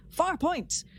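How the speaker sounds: high, emphatic Far Point in a light Picard voice; Picard exclaiming. Retold or imitated. Quoting Picard to co-host, lightly imitating his exclamation. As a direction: exclaim it like Picard recognising something. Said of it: "and Picard says".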